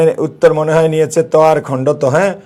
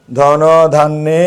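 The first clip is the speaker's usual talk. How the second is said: slow stretched word; much slower than usual. Shown as a demonstration. Teacher stretching the word slowly.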